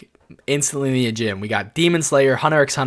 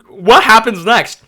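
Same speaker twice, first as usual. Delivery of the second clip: higher, louder and slow; anxious eagerness; 7 semitones higher, 8 dB louder, slower than usual. Retold or imitated. Reader stuck mid-cliffhanger wanting to know more, eager. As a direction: beg for the next page. Desperate to keep reading.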